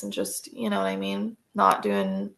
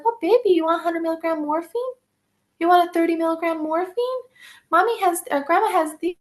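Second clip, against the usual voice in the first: much higher, sweet doting grandmother voice; doting Nana; 8 semitones higher than usual. Retold or imitated. Nana offering her granddaughter morphine for a headache, high and cooing. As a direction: coo it up high like a grandma spoiling her baby.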